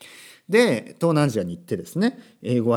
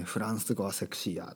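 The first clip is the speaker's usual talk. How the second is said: softly quoted line in Kansai dialect; 5 semitones lower, 10 dB quieter than usual. Retold or imitated. Quoting what everyone says about French, in Kansai dialect. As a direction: slip lightly into Kansai intonation, dropped softer. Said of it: "なんで今関西弁になったのか".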